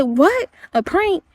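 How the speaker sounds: high, incredulous retold outburst; mother reacting to the son's burn, alarmed and high; retold outrage. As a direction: replay her disbelief, voice climbing high. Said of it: "And I said".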